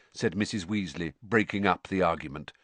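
ordinary talk

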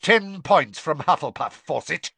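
loud, cutting snarl. Acted. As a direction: bark the penalty. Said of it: "Snape snarled".

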